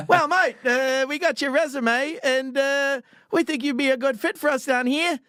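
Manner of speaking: high, hammed-up Aussie recruiter; exaggerated Australian phone voice; Australian accent. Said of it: "was real Australian accent".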